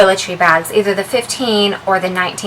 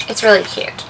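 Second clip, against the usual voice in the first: ordinary talk, nothing marked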